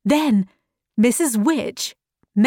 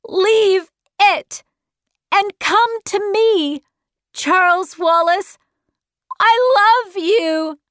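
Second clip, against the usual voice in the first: high, raised voice, pleading with a loved one; shouted plea; 8 semitones higher than usual. A narrator's reading. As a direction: call out high and urgent. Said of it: "she shouted".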